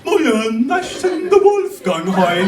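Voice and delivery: high, loud, slow dopey drawl; hammed-up goofy Luxembourgish howl; brain-damaged Luxembourgish speaker. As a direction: slur it like a German just hit on the head. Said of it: "bam, he will go like".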